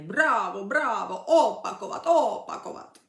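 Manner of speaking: loud crowd chant; shouted cheers. Retold or imitated. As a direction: mimic an audience calling for an encore. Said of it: "křičí na mě".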